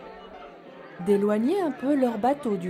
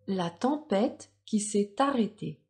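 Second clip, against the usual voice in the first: soft storytelling voice; 4 dB quieter than usual. A narrator's reading. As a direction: read gently to little children. Story narration begins.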